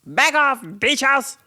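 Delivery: high, loud retold shout; hammed-up, punchy yell; mock meltdown yell. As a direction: imitate someone yelling in a meltdown. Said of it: "and yells".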